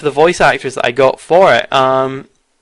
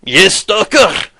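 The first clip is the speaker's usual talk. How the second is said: put-on drunk voice; 4 semitones higher, much slower than usual. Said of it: "and he goes".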